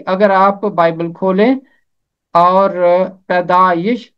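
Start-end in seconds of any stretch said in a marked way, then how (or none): none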